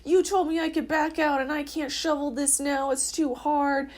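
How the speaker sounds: mock-furious at him for the stuck car; retold angry outburst. To her boyfriend about the snowed-in car, a loud angry retold complaint. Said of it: "I'm like".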